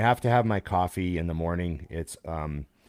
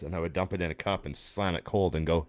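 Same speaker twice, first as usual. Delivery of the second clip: normal talking voice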